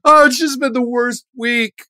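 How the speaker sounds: high-pitched tearful complaint; acted wailing confession; emotional outburst. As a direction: blubber through it, hammed-up. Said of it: "highly emotional outburst".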